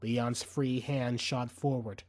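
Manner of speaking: normal talking voice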